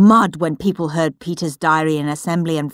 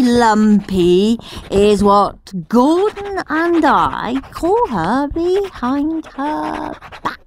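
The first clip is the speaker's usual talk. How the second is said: put-on gleeful cheeky boy; 4 semitones higher, slower than usual. Henry's doctored diary read as a slow, gleeful cheeky boy. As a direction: savour every naughty word.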